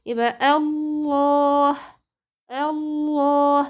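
الله drawn out very slowly with a heavy, full lam; recited Allah. Shown as a demonstration. Teacher modelling the word for her student, slow heavy recitation. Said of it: "وكتبنا الهاء معاها".

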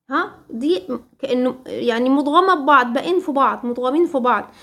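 ordinary talk

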